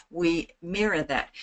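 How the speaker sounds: mirror a calm, slow talker; slow and clear. Shown as a demonstration. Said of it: "talk slowly and succinctly and clearly".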